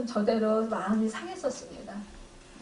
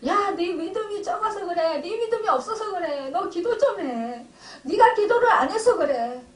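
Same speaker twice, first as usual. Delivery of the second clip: scolding father; 6 semitones higher, 4 dB louder than usual. Retold or imitated. Father on the phone scolding his sick son, loud reproach. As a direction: raise your voice and lecture him about his weak faith.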